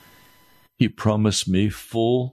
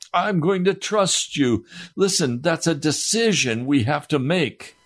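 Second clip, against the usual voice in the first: exhortation; 6 semitones higher than usual. Acted. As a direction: urge the congregation to decide. Preacher climbs again to exhortation.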